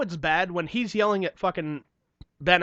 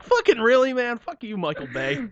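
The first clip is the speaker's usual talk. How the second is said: 1 mock outrage; 6 semitones higher, 4 dB louder, slower than usual. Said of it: "I'm just like".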